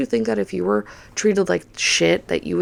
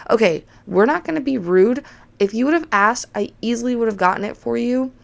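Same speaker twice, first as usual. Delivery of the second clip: higher-pitched, emphatic scolding of a customer; retold firm telling-off; 6 semitones higher than usual. Bartender correcting a rude customer, firm and indignant. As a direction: lay down the rule, pitched up.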